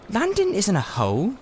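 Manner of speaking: put-on high, mildly indignant girl; a girl defending her city, offended. A narrator's reading. Polly mildly indignant at Diggory insulting London. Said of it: "said Polly indignantly".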